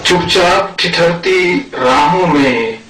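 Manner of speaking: measured ghazal recitation with long pauses; poetry recital. Acted. Poet presents his verses to a mushaira audience, poetry recital. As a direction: recite in separate phrases, lifting openings and letting ends fall.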